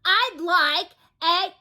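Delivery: very slow, high, loud shout; top-of-voice shout. Acted. Mister Noisy shouts at the butcher, full shout. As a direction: bellow each word. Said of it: "at the very, very top of his voice".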